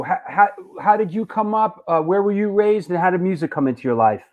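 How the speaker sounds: usual speaking voice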